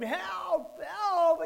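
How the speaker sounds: very high, very loud acted yell; screamed cry for help. Sinking boy calling for rescue, full acted scream. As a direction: scream it out like a trapped child. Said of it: "I yelled and screamed".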